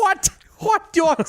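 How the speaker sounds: very high, mock East Coast outburst; East Coast accent. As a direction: squawk it in bad East Coast. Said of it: "we're terrible at this accent".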